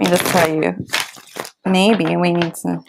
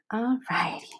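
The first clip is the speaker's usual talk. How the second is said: soft whisper; 2 semitones higher, 10 dB quieter than usual. Real, not acted. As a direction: whisper it to yourself. Whispered while shuffling cards.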